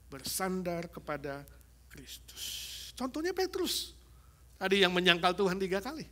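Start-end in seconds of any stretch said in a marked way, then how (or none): none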